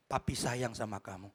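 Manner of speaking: low, soft fatherly voice; tender fatherly affection. Retold or imitated. Father hugging departing son, low tender declaration.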